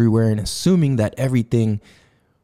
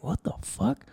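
startled hush; 9 semitones higher, 11 dB quieter than usual. Retold or imitated. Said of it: "And I was like".

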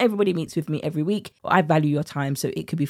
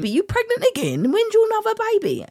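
high, sing-song nosy question; mock a nosy acquaintance, pitched way up; high teasing imitation of a nosy asker; nosy people asking. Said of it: "people keep asking".

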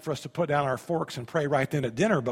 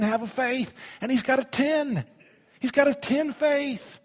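preaching; 8 semitones higher than usual. Acted. Pastor to congregation, rousing full-force preaching. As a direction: preach it up to the rafters.

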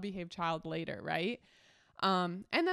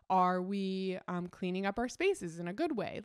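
ordinary talk

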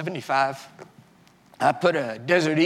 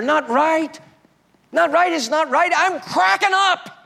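much higher, louder desperate yell; retold screaming; 13 semitones higher, 6 dB louder than usual. Husband screaming at his wife at night, desperate yell. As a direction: scream it like you're falling apart. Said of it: "I can remember screaming at her".

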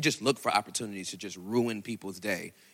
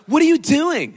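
loud, high startled question; a high-pitched, startled "what are you doing"; shocked cashier; 14 dB louder than usual. Retold or imitated. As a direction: blurt it out in shock. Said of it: "and they're like".

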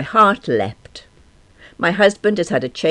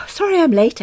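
panted; 5 dB louder than usual. A narrator's reading. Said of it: "I panted".

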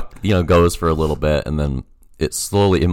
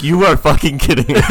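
exasperated; 10 semitones higher, 5 dB louder than usual. Retold or imitated. Friend outraged on the phone.

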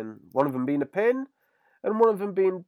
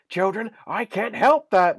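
Mo impression; 10 semitones higher, 4 dB louder than usual. Imitating Mo lashing out.

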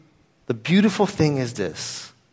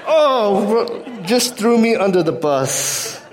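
high, drawn-out mock groan of betrayal; comic dismay; 6 semitones higher, slower than usual. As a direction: playfully wail at being given away. Retold reaction to child telling on him.

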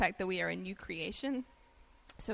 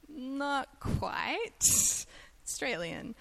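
wry reply; 6 semitones higher, much slower than usual. Retold or imitated. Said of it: "And I'm like".

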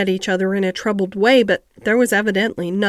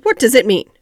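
demanding doctor; 6 dB louder than usual. A narrator's reading.

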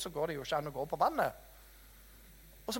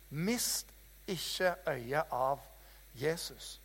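drawn out and hushed, word by word; slow quiet emphasis; 4 semitones lower, 6 dB quieter, much slower than usual. Acted. Preacher urging the congregation, slow and hushed. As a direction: lay it down slowly so it sinks in.

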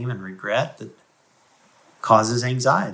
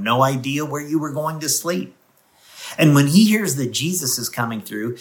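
ordinary delivery

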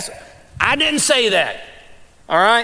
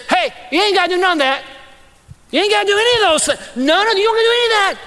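much higher, fast excited reassurance; excited Jesus voice; 8 semitones higher, much faster than usual. Retold or imitated. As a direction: rattle it off high and quick, waving it away. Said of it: "Jesus says".

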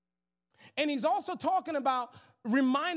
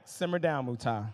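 Holy Spirit voice; 9 semitones lower than usual. Retold or imitated. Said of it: "Holy Spirit was like".

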